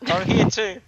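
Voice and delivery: high, loud, playful peeking voice; playful character voice. Acted. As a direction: pitch up high and call through the door. Player playing listening character.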